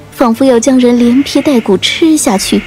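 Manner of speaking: ordinary delivery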